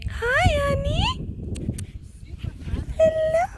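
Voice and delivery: high, loud, delighted hello to a kitten; excited high greeting. Real, not acted. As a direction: burst out with joy as she jumps up. Greeting the jumping cat.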